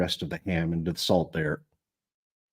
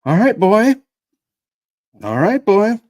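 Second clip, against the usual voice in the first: put-on boy voice; 12 semitones higher, 10 dB louder than usual. Boy talks to his dog.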